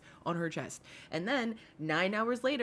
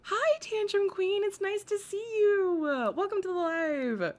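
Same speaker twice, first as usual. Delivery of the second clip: cheery greeting; 11 semitones higher, 4 dB louder than usual. Real, not acted. Greeting a viewer on stream.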